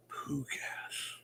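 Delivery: breathy whispered echo of poo gas. Pretend. Streamer whispering to chat. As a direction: hush it like a secret joke. Repeating his own silly phrase.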